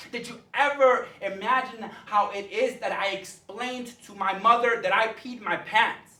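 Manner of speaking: forceful, rhythmic spoken-word delivery; slam poetry. Acted. Poet addressing the teacher Missus Parrott, impassioned slam delivery. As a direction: perform it like a high-energy slam poem.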